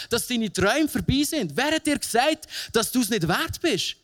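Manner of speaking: high, insistent repeated questions; preaching at full force. Acted. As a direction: hammer each "Wer hat dir gesagt" home. Repeated rhetorical questions, building.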